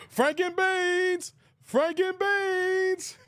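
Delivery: high, slow, drawn-out squeal; squealed movie quote. Retold or imitated. Streamer gleefully quoting the movie line, drawn-out squeal. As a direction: stretch it out high and delighted.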